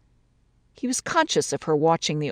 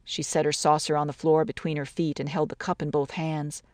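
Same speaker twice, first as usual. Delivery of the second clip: plain reading voice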